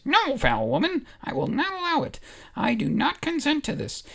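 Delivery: high, huffy refusal; indignant Ewok. Acted. Ewok refusing Holdo's hug, high and indignant. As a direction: scold her like a proud little warrior.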